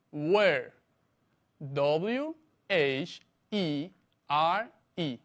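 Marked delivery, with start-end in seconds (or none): 1.7-5.2 slow, spaced-out letters; spelling out. Shown as a demonstration. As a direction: dictate the word letter by letter. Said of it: "se escribe".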